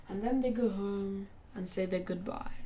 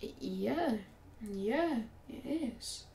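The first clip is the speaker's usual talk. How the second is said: stunned stammer; 8 dB quieter, much slower than usual. Acted. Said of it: "Iruka is shocked".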